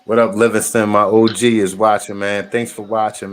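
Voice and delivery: loud excited greeting; loud shout-out. Real, not acted. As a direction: call it out big and loud. Shout-out to viewer.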